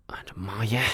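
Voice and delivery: unvoiced startled whisper; hushed 'oh no' to an angry friend; whispered exclamation. A narrator's reading. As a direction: gasp it under your breath.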